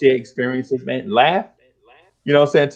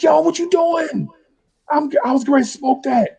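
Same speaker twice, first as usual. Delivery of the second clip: alarmed protest. Retold or imitated. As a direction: protest as the cigar gets wrecked. Friend ruins the cigar.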